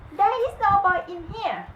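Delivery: high covering wife's voice; giant's wife. A narrator's reading.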